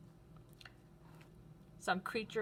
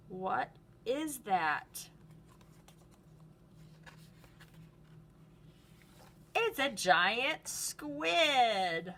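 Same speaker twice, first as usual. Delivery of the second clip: mock surprise reveal; 3 semitones higher, much slower than usual. Teacher showing kids a page, playful big reveal. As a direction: drag it out then spring the answer.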